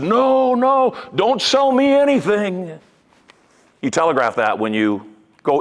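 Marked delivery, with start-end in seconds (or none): 0.0-2.8 the subconscious voice crying no, don't sell me; panicked refusal. Retold or imitated. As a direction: recoil from being sold to. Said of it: "subconscious program ... said".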